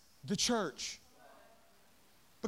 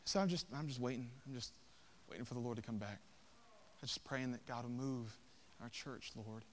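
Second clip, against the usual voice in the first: very soft, low, falsely humble voice; mock meekness; 10 semitones lower, 14 dB quieter than usual. Preacher mocking a passive church waiting on God, falsely meek. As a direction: play meek and passive, barely audible. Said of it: "cloaked ourselves with false humility and a false sense of meekness".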